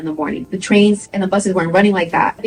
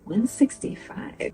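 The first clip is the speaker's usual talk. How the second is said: slow, softer whispered disbelief; shocked mom; 3 semitones higher, 11 dB quieter, much slower than usual. Retold or imitated. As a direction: breathe it out in quiet shock. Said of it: "even my mom was like".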